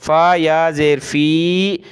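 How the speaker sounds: फ़ी held with a long ee; long vowel. Shown as a demonstration. Teacher showing students the stretch before explaining the rule, long फ़ी. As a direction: draw out the ई in फ़ी. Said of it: "खींचना होता है".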